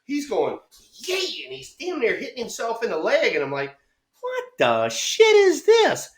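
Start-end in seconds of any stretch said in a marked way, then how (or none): 1.1-1.4 very high mock "yay" squeal; high goofy swing sound; imitated swing noise. Mimicking the player's swing. 4.2-6.1 high, slow, loud mock bewilderment; hammed-up incredulous reaction; baffled disbelief. Retelling his baffled first reaction.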